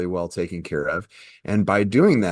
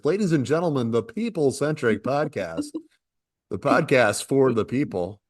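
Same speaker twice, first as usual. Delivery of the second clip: radio announcer voice; 5 semitones higher than usual. Acted. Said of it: "I was using my radio show voice".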